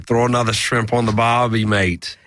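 put-on lightly done Australian accent; a touch of Aussie mate voice. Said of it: "How about your Australian?".

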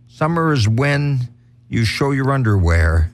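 ordinary talk, nothing marked

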